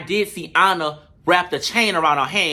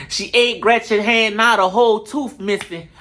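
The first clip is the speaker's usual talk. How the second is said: higher, slow, put-on rapper drawl breaking into a laugh; 4 semitones higher than usual. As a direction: deliver the diss slowly in someone else's voice. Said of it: "in my J T voice".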